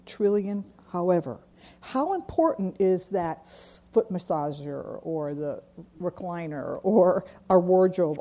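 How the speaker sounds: usual speaking voice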